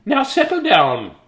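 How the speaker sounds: high, loud, slow command; stern teacher. Acted. Reading aloud to a rowdy class, a stern teacher's command. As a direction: bark it at the class. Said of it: "Oh, that's not a sweet voice".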